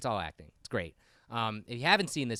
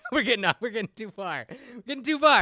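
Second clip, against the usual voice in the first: laughing; 8 semitones higher, 4 dB louder, faster than usual. Real, not acted. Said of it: "laughing at own joke".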